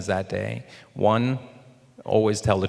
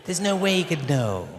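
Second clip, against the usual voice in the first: imitated hopeful thought; 7 semitones higher than usual. Actor convincing himself his boss is unaware, higher voice. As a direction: think it aloud, pitched up.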